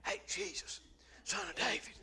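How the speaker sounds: tiny, barely-there whispered call; mock timid whisper. Preacher mimicking a beggar too quiet for Jesus, whispering. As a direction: whisper it as if afraid to be heard. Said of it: "he didn't just say".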